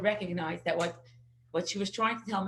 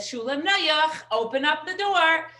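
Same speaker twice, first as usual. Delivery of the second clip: calling the son; 5 semitones higher, 6 dB louder than usual. Retold or imitated. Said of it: "she says".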